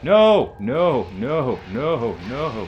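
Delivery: sudden panicked no, no, no; rising alarm. Real, not acted. Player panics at game event.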